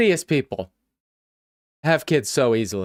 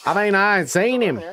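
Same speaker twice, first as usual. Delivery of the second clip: mock Southern accent, a touch higher; imitating a Southern accent; 5 semitones higher than usual. Streamer mimics the suspect in a Southern accent. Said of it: "I love the Southern accent so much".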